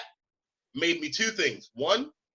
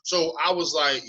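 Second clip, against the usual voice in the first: ordinary delivery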